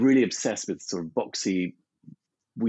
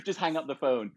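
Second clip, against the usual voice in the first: ordinary delivery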